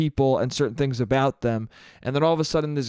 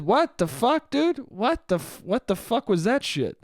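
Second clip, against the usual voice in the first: higher-pitched, incredulous disgust; mock disgust with swooping questions; 7 semitones higher than usual. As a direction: act out a blurted, appalled reaction. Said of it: "just a disgusted".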